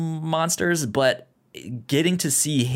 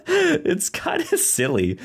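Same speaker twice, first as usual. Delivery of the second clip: laughing. Real, not acted. Laughing after silly scene.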